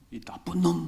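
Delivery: slow, punched imitation of an angry curse; imitated cursing. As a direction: act out a labouring mother swearing, drawn out. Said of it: "아주머니가 막 욕을 막".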